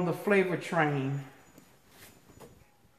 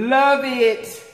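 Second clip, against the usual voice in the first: delighted exclamation; 5 semitones higher, 5 dB louder than usual. Real, not acted. Delighted by Flavor Train shirt.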